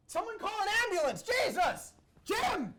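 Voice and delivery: frantic high yelling for help; panicked shouting. Acted. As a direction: shout in alarm, fast and high. Host collapsed, calling for help.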